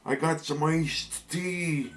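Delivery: put-on high Buffalo accent, slowing down. Cousin Saul chatting to Mario, in a high Buffalo accent. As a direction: keep the dopey Buffalo cousin going. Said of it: "a Mario brother from Buffalo".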